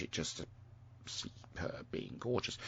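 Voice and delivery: soft whispered swoon; whispering. Real, not acted. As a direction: whisper it dreamily. Swooning over a presenter.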